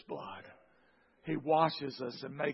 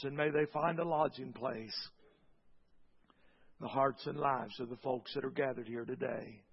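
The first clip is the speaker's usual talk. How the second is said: prayer. Acted. Closing prayer.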